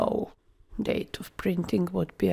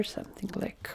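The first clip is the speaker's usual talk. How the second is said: whispered under the breath while working; a quick aside, whispered; muttered whisper; 16 dB quieter, faster than usual. Real, not acted. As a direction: mutter it to yourself as you type. Muttering to self while typing.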